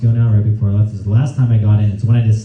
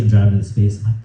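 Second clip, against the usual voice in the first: ordinary delivery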